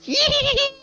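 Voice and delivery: very high creature squeal; long, high-pitched gleeful shriek. Acted. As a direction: squeal "yee" as you dash away. Skeek dashes off after announcement.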